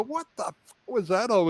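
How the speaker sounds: high, startled what-the; surprised outburst. Retold or imitated. Clinician coming out asks host what happened, surprised. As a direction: blurt it out in disbelief.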